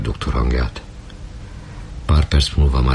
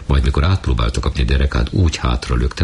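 ordinary delivery, nothing marked

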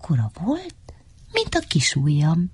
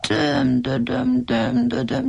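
slow, higher whining moan; painful whimper; 4 semitones higher, slower than usual. Acted. Trapped under the tree, he moans his own name in pain. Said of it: "síránkozott fájdalmasan".

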